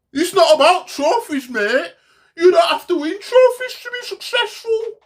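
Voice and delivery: very high, loud whiny mock-fan voice; mock the fans in a squeaky wail; Artetin fan. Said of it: "This is how they speak".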